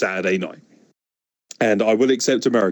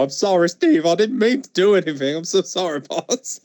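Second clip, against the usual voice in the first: high, pleading sorry-boss voice; a cowering employee begging forgiveness; groveling apology impression; 8 semitones higher than usual. Co-host imitating Kyle apologising to Steve, high and pleading. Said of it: "in the Canadian voice from South Park".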